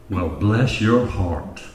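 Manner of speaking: mild Southern lady, sweetly slow; Southern drawl. Acted. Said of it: "soft-spoken woman from the South".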